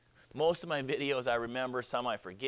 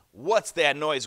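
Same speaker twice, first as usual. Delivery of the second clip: loud, forceful dragon demand; big booming beast voice; growling dragon; 10 dB louder, slower than usual. Acted. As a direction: growl it out loudly. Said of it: "growled the real dragon".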